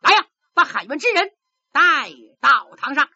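来呀 把喊冤之人带到堂上 barked fast and loud; official's command. Acted. Magistrate slams the gavel and orders the plaintiffs in, fast and loud. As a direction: snap the order across the hall. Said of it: "施伦一拍惊堂木".

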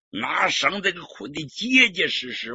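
ordinary delivery

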